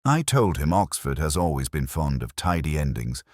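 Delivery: ordinary delivery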